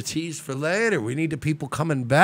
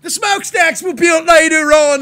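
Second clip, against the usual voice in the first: high shouted rant; shouting; 9 dB louder than usual. Acted. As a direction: bellow it out, high pitched. Continues troll rant shouting.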